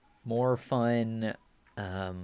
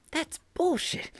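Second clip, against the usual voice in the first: very high, slow indignant squeal; outraged squeak. Acted. Character outraged.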